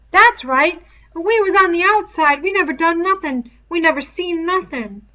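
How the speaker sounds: high, loud, eager; eager agreement. A narrator's reading. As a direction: jump at the excuse eagerly. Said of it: "Piggy nodded eagerly".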